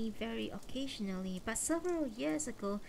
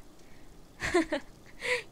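a light airy laugh, higher; short laugh; 11 semitones higher, 3 dB louder than usual. Acted. Mother laughs at Nikki's scolding, a light airy laugh. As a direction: let out a small breathy laugh. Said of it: "I laughed".

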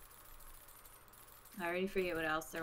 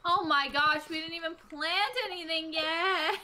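raised, higher exclamation of dismay; shocked dismay; 7 semitones higher, 4 dB louder than usual. Real, not acted. Player realises nothing got planted, high dismayed outburst. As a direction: exclaim it, aghast at lost time. Learns the day is nearly gone.

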